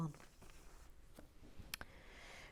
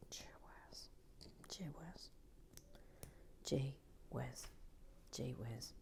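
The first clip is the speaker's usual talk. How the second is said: fading gee whiz; 4 semitones lower, 18 dB quieter than usual. Acted. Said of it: "repeated gee whiz, fading to whisper".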